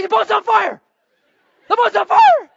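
loud, high-pitched panicked yell; freaked-out shouting. Retold or imitated. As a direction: act out raising a frantic alarm. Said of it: "I freak out".

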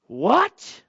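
startled outburst; shocked what. Retold or imitated. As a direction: react as if cheated. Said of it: "And I just went".

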